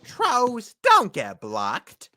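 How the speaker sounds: slow, stretched-out catchphrase; drawn-out meme quote. Retold or imitated. Friend repeats the troll catchphrase, slow and stretched. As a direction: drag it out for comic effect. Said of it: "repeating the catchphrase, drawn out".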